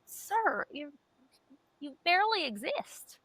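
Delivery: high, mock-haughty scolding of an ant; scoffing at the ants that bite her; mock condescension. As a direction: talk down to a tiny bug, pretend offended. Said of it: "and I'm like".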